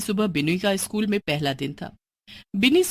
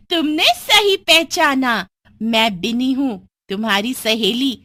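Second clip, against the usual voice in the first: evil witch; 4 semitones higher, 4 dB louder than usual. Acted. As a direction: gloat over the trapped victim.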